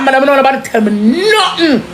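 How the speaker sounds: ordinary delivery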